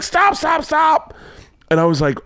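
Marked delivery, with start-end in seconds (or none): 0.0-1.1 very high-pitched repeated cry; high, urgent retold calls; child calling out. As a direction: holler like an eager kid after a car. Child chasing down a cop car.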